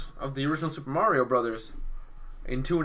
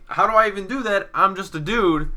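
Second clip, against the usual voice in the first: high, louder protest; 7 semitones higher, 4 dB louder than usual. Acted. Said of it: "Marky's like".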